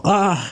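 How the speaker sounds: sharp high cry of pain; yelp. Acted. Ludwig yelps when Laban pinches his tail. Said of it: "Людвиг закричал".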